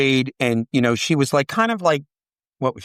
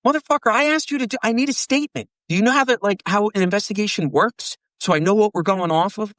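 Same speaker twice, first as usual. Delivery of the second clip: high, fast, fed-up mock rant at a witness; exasperated retold rant; 6 semitones higher than usual. Cop venting at a witness who won't give a statement, high and fast exasperated rant. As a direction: blow up at them like an irritated cop.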